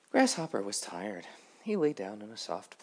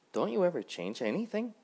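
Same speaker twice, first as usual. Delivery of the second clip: higher, louder curious grasshopper voice; lively Grasshopper; 11 semitones higher, 4 dB louder than usual. Acted. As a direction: ask it brightly, a bit higher.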